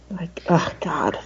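breathy horrified whisper. Real, not acted. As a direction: drop to a whisper, appalled. Horrified aside after Joffrey quote.

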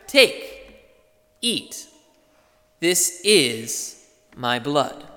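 slow, with "is" drawn out and weighted; stress on is. Shown as a demonstration. Pastor reading the words of institution, stressing "is". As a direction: linger on "is" as if it carries everything. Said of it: "we put so much emphasis on that little word is".

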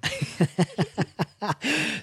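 high staccato giggle; helpless, rippling laughter; real burst of laughter. Real, not acted. Laughing at the French-sounding attempt.

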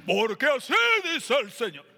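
big, shouted pulpit voice; booming preacher voice. Retold or imitated. Pastor switching to his pulpit voice. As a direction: boom it out like a televangelist. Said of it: "Que cambió hasta la voz".

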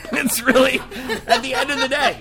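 high, laughing through words. Real, not acted. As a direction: giggle as you talk. Laughing mid-sentence.

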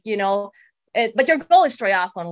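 ordinary delivery

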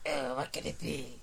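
smoker's rasp. Retold or imitated. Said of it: "that's a bit of a smoker's voice".

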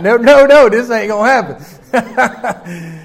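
loud, then a slow laughing finish; mock protest. Wife telling the cashier off, loud, half laughing. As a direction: ham up a jealous wife.

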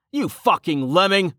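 high-pitched, angry yell; exasperated shout. A narrator's reading. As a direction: curse out a reckless friend. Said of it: "Rain shouted".

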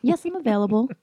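high, sweet client-pleasing phone persona. Retold or imitated. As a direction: play the sweet escort answering a client's call. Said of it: "I played the role".

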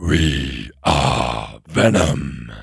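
very low, drawn-out monster voice; slow, throaty creature growl; Venom. Acted. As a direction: drop far down and drag out each word, straining the throat. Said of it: "I did the Venom voice".

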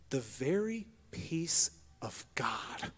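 slow, hushed whisper of the verse; whispered scripture. Acted. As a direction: breathe the verse out slowly in a whisper. Whispered phrase.

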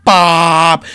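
one loud drawn-out blast; mock outburst, long and loud; explosive shout. Acting out blowing up at everybody.

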